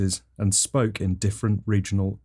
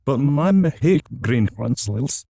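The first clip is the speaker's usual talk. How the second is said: slow, higher, garbled backwards Scottish; backwards Scottish accent; 5 semitones higher, 3 dB louder, slower than usual. Acted. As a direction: protest in slowed, reversed-sounding Scottish. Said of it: "spoke in sort of backwards Scottish accent".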